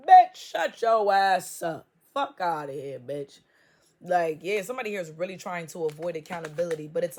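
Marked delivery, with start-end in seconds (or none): 0.1-3.4 loud, slow shout; yelled comeback. Acted. Reader shouting down a mocked hater. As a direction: blast it right back at them. Snapping back at imagined hater.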